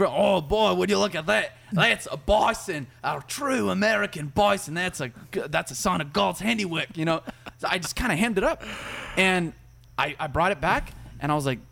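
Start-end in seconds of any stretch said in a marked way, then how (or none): 0.0-7.0 slow, amazed Australian nature-host voice; Steve impression. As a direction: gush over the bison like an Aussie wildlife presenter. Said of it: "like, the Steve".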